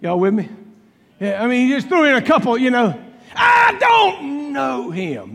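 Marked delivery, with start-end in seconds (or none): 3.3-5.3 slow, drawn-out shouted denial. Retold or imitated. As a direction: bellow it slowly to the crowd. Peter's denial, voiced by the preacher.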